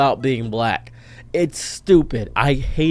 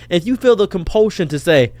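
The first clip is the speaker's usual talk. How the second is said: normal talking voice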